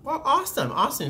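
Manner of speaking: put-on high-pitched cheer for a kid; upbeat response. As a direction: react brightly to a child's small news. Cheering a child passenger on.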